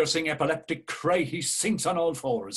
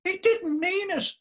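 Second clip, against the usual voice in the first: much higher put-on little voice; squeaky pleading voice. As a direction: pipe up like a tiny chorus.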